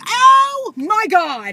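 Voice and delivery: high, very loud, slow scream; run-over bug horror shriek; horrified shriek. Retold or imitated. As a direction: shriek at the crunch. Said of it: "I was like".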